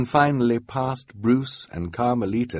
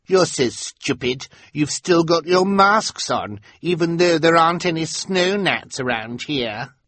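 put-on high bratty girl voice; bratty schoolgirl taunt; snotty Carmelita; 9 semitones higher, 3 dB louder than usual. A narrator's reading. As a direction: sneer at the strangers. Carmelita insults.

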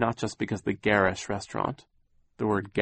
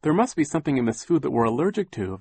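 put-on a touch higher, a girl explaining; lightly voiced girl, slightly raised; a lightly voiced Violet; 6 semitones higher, 4 dB louder, faster than usual. A narrator's reading. Violet telling the adults, lightly voiced girl. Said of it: "Violet said".

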